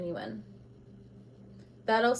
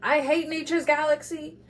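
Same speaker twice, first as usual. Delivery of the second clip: higher, mocking voice of an angry viewer; mock hater quote; 6 semitones higher, 4 dB louder than usual. Tarot reader mocking an angry viewer, higher and sneering. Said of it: "it's gonna be like".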